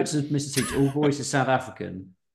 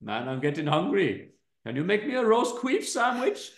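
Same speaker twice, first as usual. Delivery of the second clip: higher hammy Indian voice; Indian accent; 6 semitones higher than usual. Acted. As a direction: keep the cheeky Indian lad. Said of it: "gonna plump on Indian".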